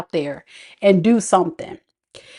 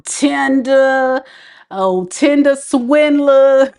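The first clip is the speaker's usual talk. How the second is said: teasing drawl; 3 semitones higher, much slower than usual. Acted. Teasing listeners on dating apps.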